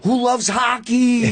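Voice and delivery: high, drawn-out hype-man call; imitating Dice. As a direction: stretch out a sample crowd question. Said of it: "And then be like".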